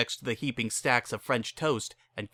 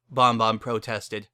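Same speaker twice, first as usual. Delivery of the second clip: ordinary delivery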